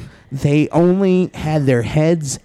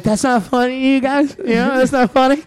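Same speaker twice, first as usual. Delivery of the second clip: higher, prim scolding; mock scolding; 9 semitones higher, faster than usual. Telling the silent audience off, mock offended and higher. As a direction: pretend to be offended. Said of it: "And I was like".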